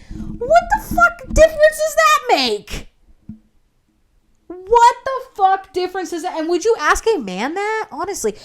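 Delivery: very high, squealing indignation; outraged. Retold or imitated. Applicant challenging manager over kids question, outraged. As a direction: pitch shoots up in exasperated outrage.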